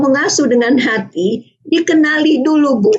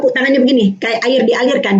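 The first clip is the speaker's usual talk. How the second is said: ordinary delivery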